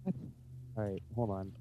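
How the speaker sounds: far-off muffled voice; distant, like from the kitchen; from another room. Real, not acted. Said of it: "yelling from your other room".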